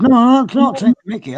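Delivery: high, loud mock protest; retold protest. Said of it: "And I sort of said".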